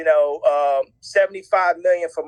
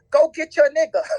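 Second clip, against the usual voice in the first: higher-pitched mock command; quick higher quoted order; retold urging; 8 semitones higher than usual. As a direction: imitate them sending someone off to intervene. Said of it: "so they say".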